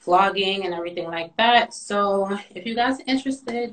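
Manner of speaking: normal talking voice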